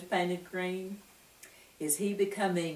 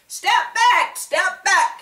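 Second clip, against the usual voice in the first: high, loud official call; mayor clearing the street, loud and high; announcing loudly; 12 semitones higher, 9 dB louder, slower than usual. A narrator's reading. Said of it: "he announced".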